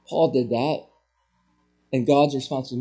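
ordinary delivery

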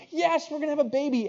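very high, thrilled answer; acted wife's gleeful yes; elated confirmation. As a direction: confirm it high-pitched and overjoyed. Wife confirms the joyful news.

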